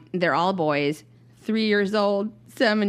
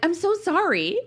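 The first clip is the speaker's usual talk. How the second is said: over-apologetic; 10 semitones higher than usual. Retold or imitated. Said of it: "I kept being like".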